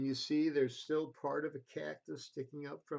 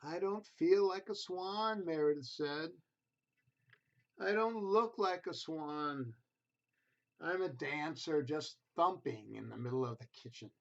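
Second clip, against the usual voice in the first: gentle storybook reading voice; reading to children; 3 dB louder than usual. A narrator's reading. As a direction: read it like a bedtime picture book. Reads picture book to children.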